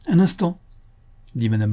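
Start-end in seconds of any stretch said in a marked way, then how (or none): 0.1-0.6 sudden higher-pitched interjection; interrupting. A narrator's reading. Madame Lacombe cutting off Louis, interrupting. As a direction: cut in sharply on the young man. Mother cuts the couple short.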